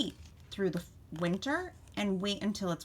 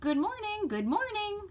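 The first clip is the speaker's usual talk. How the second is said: high, sing-out mouse greeting; two little mice; 12 semitones higher than usual. A narrator's reading. Two mice greeting from under leaves, high-pitched squeak. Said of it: "said the two mice".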